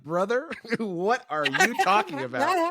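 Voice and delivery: high-pitched, incredulous laughing question; baffled disbelief. Retold or imitated. Podcaster answering an absurd comment about him, laughing disbelief. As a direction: question him in amused, high-voiced bewilderment.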